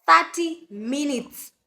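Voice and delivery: urgent emphasis on the deadline; stress on thirty. Real, not acted. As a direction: lean on "Thirty" like time is running out. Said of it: "panicked emphasis on time left".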